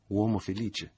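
loud, mocking congratulation; ironic exclamation. A narrator's reading. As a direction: toss it out with open irony. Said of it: "esclamò Michele ironico".

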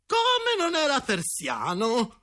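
a lady shrieking in outrage; outraged yell. A narrator's reading. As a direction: burst in screaming. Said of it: "urlò in quel momento la sora Matilde".

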